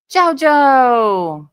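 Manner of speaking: loud high delighted cry of the name; excited shout. Real, not acted. Streamer greeting JoJo in chat, loud and overjoyed. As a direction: call his name out in real joy.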